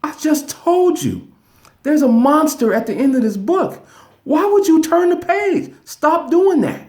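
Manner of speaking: high, loud puppet voice, scolding; exasperated Grover. Retold or imitated. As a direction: squeak up high like a fed-up Muppet. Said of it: "there's Grover like".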